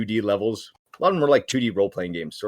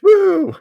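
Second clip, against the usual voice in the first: high, loud "woo"; mock cheering whoop; 8 dB louder than usual. Cheering on a pretend doodle war, loud whoop. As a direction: whoop like a fan in the stands.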